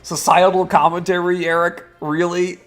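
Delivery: incredulous. Acted. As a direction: repeat it as if you can't believe it. K-Man echoes in disbelief.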